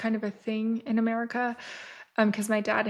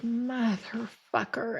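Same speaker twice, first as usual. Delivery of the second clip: slow, dragged-out, fed-up groan of a curse; weary curse; much slower than usual. Retold or imitated. To producers insisting she join the shark swim, a slow fed-up curse. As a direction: drag the word out like you're already defeated.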